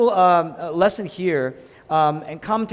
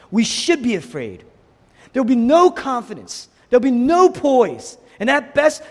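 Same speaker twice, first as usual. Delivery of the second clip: high, loud sermon delivery; forceful preaching; 7 semitones higher, 6 dB louder than usual. Real, not acted. Preacher warning the congregation life is out of control, forceful preaching. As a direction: preach it with raised, driving force.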